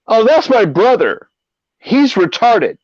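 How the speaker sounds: high, earnest-sounding cover story. Retold or imitated. Friend fooling the cop with a cover story, high and mock sincere. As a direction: play it up as the innocent brother.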